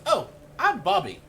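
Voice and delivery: put-on high, loud child voice; dwarf boy. Dwarf boy introduces himself, high and loud. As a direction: pipe up loudly like a little boy. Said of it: "He goes".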